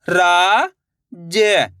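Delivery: रा and ज each drawn out alone, with a gap; syllable by syllable. Shown as a demonstration. Teacher showing learners each letter, syllable by syllable. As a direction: sound out रा, ज one at a time. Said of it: "एक अक्षर का उच्चारण करके".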